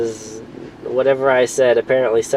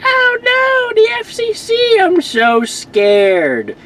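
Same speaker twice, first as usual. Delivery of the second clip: much higher, slow mocking whine; sarcastic mock fear; much slower than usual. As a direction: pretend to be scared, dripping sarcasm. Mocking the FCC threat.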